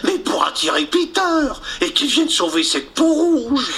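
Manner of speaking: high bumbling sailor voice; simple sailor voice. Acted. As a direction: explain it like a dim sailor. Mouche explains to captain.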